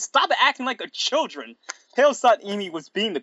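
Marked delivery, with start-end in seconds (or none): 0.0-1.6 high-pitched mock scolding; mad, serious. A narrator's reading. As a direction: tell them off with exaggerated seriousness. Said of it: "in a mad, serious voice".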